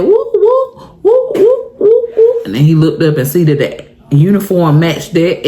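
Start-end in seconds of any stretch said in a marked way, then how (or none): 0.0-2.5 high whooping siren sound; child playing police siren; siren imitation.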